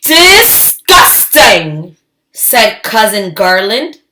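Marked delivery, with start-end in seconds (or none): 0.0-2.0 slow, loud drawn-out disgust. Acted. Cousin Garland sneering at the baby, slow and disgusted. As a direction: drag it out with a sneer.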